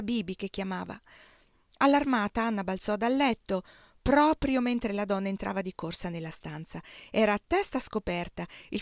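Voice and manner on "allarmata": ordinary delivery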